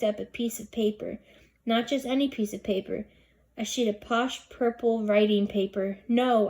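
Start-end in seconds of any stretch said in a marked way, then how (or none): 6.1-6.5 loud panicked no; a loud, alarmed shout of no; yelled. A narrator's reading. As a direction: yell it out to stop her. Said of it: "I yelled".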